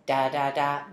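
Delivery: normal talking voice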